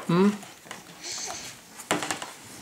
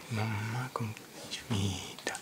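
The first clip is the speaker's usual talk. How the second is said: soft unvoiced whisper; 5 semitones lower, 14 dB quieter than usual. Real, not acted. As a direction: breathe it as a whisper. Whispered aside.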